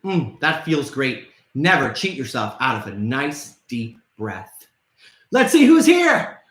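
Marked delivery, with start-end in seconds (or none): 0.1-4.7 calm exhale. Real, not acted. After calming breathing exercise.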